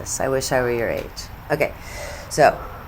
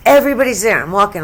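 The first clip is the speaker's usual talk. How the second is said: normal talking voice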